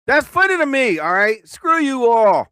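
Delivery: high, loud comic protest; loud defiance. Real, not acted. Streamer defending his juvenile joke, loud defiance. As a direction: shout it back at the mockers, dragging the last words.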